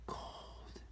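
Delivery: breathy whisper on cold. Acted. Reading the bird verse, whispering the final cold. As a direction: drop to a chilly whisper.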